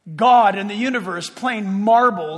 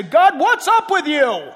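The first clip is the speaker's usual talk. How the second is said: exasperated Joshua; 6 semitones higher, 8 dB louder, slower than usual. Retold or imitated. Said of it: "Joshua is about to say".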